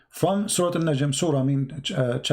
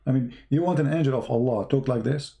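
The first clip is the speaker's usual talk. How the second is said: ordinary delivery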